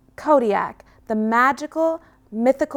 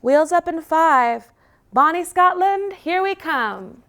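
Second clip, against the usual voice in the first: high, loud cheerful pilot call; announcing; 8 semitones higher, 4 dB louder than usual. Acted. Pilot announcing takeoff to the pack, high and loud. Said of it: "Captain Chinook announces".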